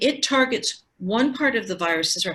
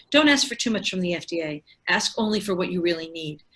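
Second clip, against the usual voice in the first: ordinary delivery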